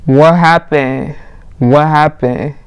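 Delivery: drawn-out, lightly voiced guy's question; guy checking on her. Guy to passed-out girl, slow imitated concern. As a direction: slowly imitate a puzzled guy.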